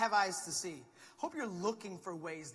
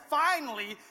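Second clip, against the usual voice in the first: dismissive relief; 6 semitones higher, 12 dB louder, slower than usual. Retold or imitated. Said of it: "We're just like".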